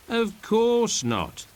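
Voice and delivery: high, slow jolly reply; amused reassurance. A narrator's reading. Stefano reassuring Caliban in a high jolly voice. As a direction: laugh it off, drawn out. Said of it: "says Stefano".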